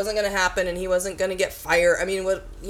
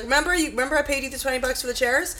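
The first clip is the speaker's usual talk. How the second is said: quoted exchange; 7 semitones higher, faster than usual. Retold or imitated. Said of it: "He was like".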